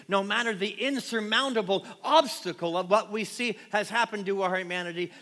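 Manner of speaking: loud, rising sermon voice; full-force preaching. Acted. Pastor rousing the congregation on Palm Sunday, full-force preaching.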